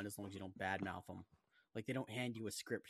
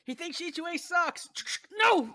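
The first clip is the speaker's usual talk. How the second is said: mock outraged yell; shouting; 11 dB louder, slower than usual.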